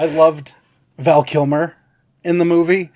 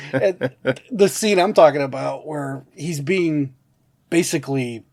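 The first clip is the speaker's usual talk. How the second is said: ordinary talk, nothing marked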